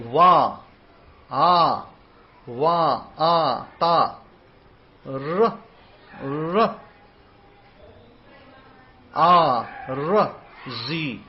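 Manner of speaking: Wa, A, Ta, R, Z as separate sounds with pauses; deliberate letter-sound recitation; sounded-out syllables. Shown as a demonstration. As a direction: drill "Wa, A, Wa, A, Ta" one syllable at a time. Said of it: "these are the sounds that you're supposed to say".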